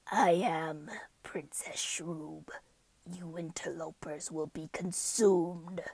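slow, soft, hissed whisper; menacing hissing whisper; hissing villain. Acted. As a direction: hiss the threat like a serpent queen. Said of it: "I'm gonna try the hissing".

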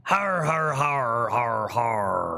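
hearty pirate har har; pirate laugh. Acted. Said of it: "this little pirate voice I've been practicing".

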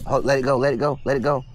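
ordinary talk

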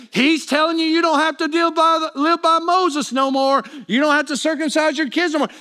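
a hint of accusing troublemakers; stirring up crowd. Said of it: "and saying".

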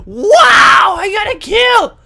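shrieking with joy; excited shout. Real, not acted. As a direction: yell it out, thrilled.

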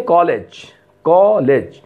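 कॉलेज with a rounded open aw vowel; teacher modelling the English vowel. Shown as a demonstration. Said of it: "इनका उच्चारण होता है कॉलेज".

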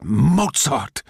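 loud, high outburst of outrage; outraged cry. A narrator's reading. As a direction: call out the name, truly outraged. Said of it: "von Strach was truly outraged".

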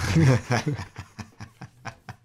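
loud burst of laughter; a real laugh at his own joke. Real, not acted. Cracking up after his mock accent.

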